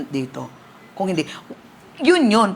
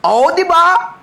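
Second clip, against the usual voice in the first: excited reply; 10 semitones higher, 8 dB louder, slower than usual. Retold or imitated. Retold reply of healed woman.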